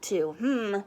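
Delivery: ordinary delivery, nothing marked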